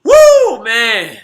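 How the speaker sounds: very high, loud whoop that falls away; exhilarated whoop. Real, not acted. Fan after his team's goal, exhilarated whoop. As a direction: let out a big release of tension.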